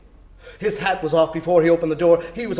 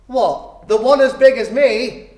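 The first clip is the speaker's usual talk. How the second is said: higher, louder lad with a laugh; a boy gaping at the huge turkey; amazed boy; 5 semitones higher, 7 dB louder than usual. Acted. The boy asking about the big turkey, amazed and laughing.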